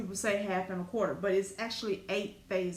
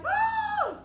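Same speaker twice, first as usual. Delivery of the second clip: a very high, loud startled cry; shocked yelp; 5 dB louder than usual. Retold or imitated. Seeing big hickey marks on her inner thigh, high shocked yelp. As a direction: yelp as the shock hits you. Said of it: "I was like".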